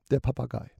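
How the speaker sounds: ordinary delivery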